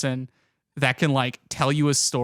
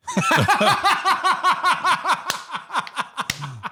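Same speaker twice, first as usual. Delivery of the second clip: high cackle. Real, not acted. Laughing at own pun.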